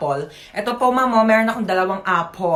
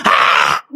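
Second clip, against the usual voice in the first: scream; 6 dB louder than usual. Acted. Zombie attack scream.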